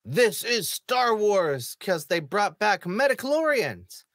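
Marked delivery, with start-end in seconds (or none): 0.0-1.7 slow, drawn-out grand declaration; mock fan proclamation. Vlogger mocking fans, slow mock-grand fan declaration. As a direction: announce it like a devoted fan, slowly. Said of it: "this is your moment to say".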